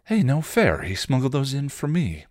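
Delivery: put-on high-pitched girlish protest; protesting kid sister. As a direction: pipe up high in mock complaint. Young Dawn protests in the story.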